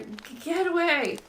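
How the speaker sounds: high, slow, stammering plea to get away; terrified stammer. Acted. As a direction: stutter it out in panic, pitch way up. Chris backs into corner.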